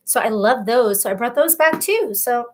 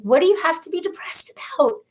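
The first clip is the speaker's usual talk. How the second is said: higher, whispered disbelief; whispered thought; 6 semitones higher than usual. Retold or imitated. Recalling her younger self's thoughts about comedians, incredulous whisper. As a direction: whisper it as a private judgment. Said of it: "I would think".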